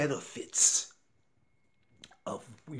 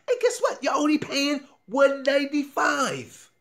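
much higher and louder pitch reveal; excited reveal; 11 semitones higher, 7 dB louder than usual. Real, not acted. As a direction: build to the price like a game-show host.

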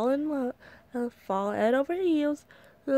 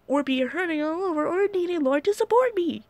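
indignant complaint; 7 semitones higher, 4 dB louder than usual. Acted. Complaining to the boys.

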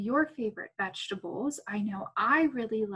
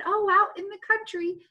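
put-on child's answer; 9 semitones higher, 4 dB louder than usual. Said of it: "if you said".

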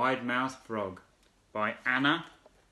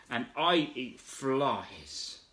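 slow proud; 3 dB quieter, much slower than usual. Acted. Frog's proud reply.

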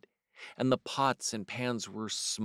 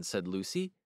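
ordinary delivery

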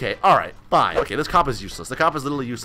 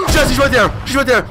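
sudden high gasp, then a loud cry; shocked yell at spotting her on camera; gasp and shout; 8 semitones higher, 5 dB louder, faster than usual. Real, not acted. Gamer spots stalker on camera, shocked yell.

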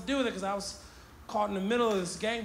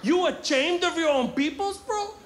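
louder, higher Chicano tough-guy voice; 7 semitones higher, 4 dB louder than usual. Retold or imitated. Gang guy confronting the kid walking by, loud Chicano accent. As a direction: get in his face, vato. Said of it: "He was like".

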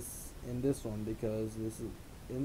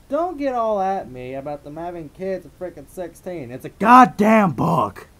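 higher, then yell the last phrase hard; exasperated outburst; 4 semitones higher, 11 dB louder than usual. Pretend. Narrator warning listeners about the book, exasperated shouting. Scolds listeners over story detail.

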